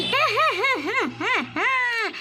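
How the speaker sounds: high, loud rhythmic "hah-hah"; possessed cackle. Acted. Possessed Rani caught with the children, loud gloating cackle.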